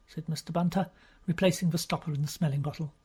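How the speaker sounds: ordinary delivery, nothing marked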